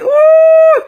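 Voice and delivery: a very high, loud lovesick squeal; love squeal. Pretend. Swooning over her husband in a hammed-up squeal. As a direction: squeal like a smitten teen.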